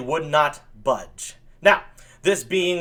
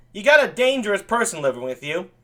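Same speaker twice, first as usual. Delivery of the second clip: ordinary talk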